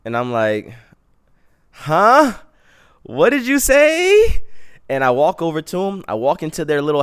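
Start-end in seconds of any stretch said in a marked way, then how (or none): 1.7-4.4 very high, loud, drawn-out disbelief; exaggerated falsetto "come again?", stretched out slowly; incredulous mock squeal. Acts out baffled reply to stranger.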